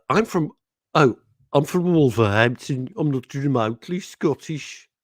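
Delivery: hammed-up Wolverhampton accent, loud at the start; mock Wolverhampton accent. Host reading a listener's message, mock Wolverhampton accent. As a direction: put on a comic Midlands accent from Wolverhampton. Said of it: "Do they actually talk like that in Wolverhampton".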